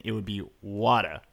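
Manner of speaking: water with a dropped r, Boston style: wah-tah; Boston accent. Shown as a demonstration. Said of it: "so in my regional accent, which is Boston".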